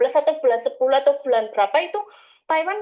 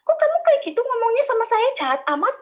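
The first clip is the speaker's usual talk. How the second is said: offended agent; 9 semitones higher than usual. Retold or imitated. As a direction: play the hurt agent complaining. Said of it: "kayak ngomong gitu".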